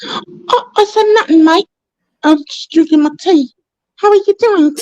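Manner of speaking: hammed-up British accent, pitched high; mock British accent. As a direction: play a Brit sipping tea, innocently. Answers in British voice.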